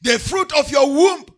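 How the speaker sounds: loud, chanting preacher's cry over music; thundering pulpit declaration of blessings; full-force preaching. Acted. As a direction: proclaim every blessing to the congregation at full force.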